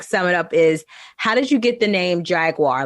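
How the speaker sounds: ordinary talk, nothing marked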